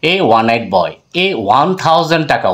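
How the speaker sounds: ordinary talk, nothing marked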